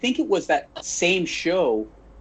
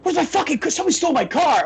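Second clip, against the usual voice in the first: much higher, building mock shriek of panic; retold frantic yell; 12 semitones higher, 3 dB louder, faster than usual. Imitating the wrestler finally losing it over the car, hammed-up shriek. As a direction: push the imitation into a hammed-up screech.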